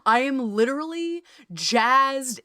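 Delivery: ordinary talk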